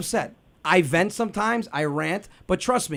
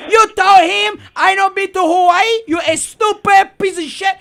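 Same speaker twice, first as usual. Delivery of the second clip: loud, higher enraged dad; flipping out; 9 semitones higher, 6 dB louder than usual. Retold or imitated. Father going off at his son, loud and enraged. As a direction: tear into the son. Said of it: "flipped out on my friend".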